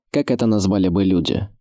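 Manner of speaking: ordinary delivery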